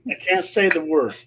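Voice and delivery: plain reading voice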